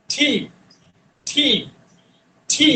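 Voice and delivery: টি said with a puff of air; aspirated tee. Shown as a demonstration. Teacher drilling an aspirated T for students. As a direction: release টি breathy. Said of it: "আজকে থেকে বলবো টি".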